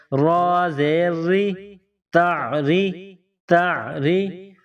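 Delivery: ری drawn out with a thin light r; slow light ra. Shown as a demonstration. Teacher spelling a light را for pupils. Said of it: "را کو باریک پڑھیں گے".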